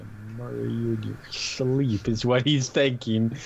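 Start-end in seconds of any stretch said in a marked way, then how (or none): 0.0-2.1 low, soft, quick threat; menacing inner thought. Acted. Player voices the dog's murderous thought, quiet menace. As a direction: mutter it low like a secret vow.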